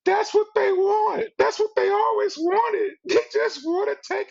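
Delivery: high, wailing mock sob; imitating the crying old lady on the plane, high and wailing. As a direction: ham up an old lady bawling. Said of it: "she sit there and said".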